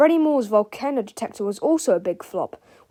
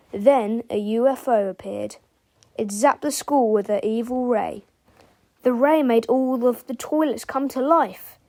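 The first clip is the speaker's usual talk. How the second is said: ordinary delivery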